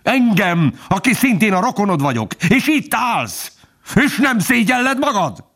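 high-pitched furious accusation, climbing; hateful, scornful tirade. A narrator's reading. Torn fox accusing his traitor kinsman, furious contempt. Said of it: "megfeszült a gyűlölettől és a megvetéstől".

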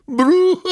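a high, hearty guffaw bursting out; booming laugh from the chest. A narrator's reading. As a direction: laugh out thick and full from inside the box. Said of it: "mégpedig jó vastagon". (harsány, vastag hahota)

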